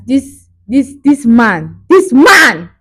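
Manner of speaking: loud, high emphasis on "man"; exasperated outrage. Real, not acted. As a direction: burst out at the nerve of him.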